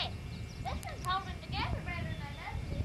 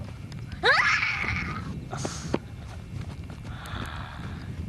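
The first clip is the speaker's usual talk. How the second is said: big high-pitched laugh; loud high laugh; 12 semitones higher, 7 dB louder than usual. Real, not acted. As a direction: burst out laughing loudly. Kid laughing after cow joke.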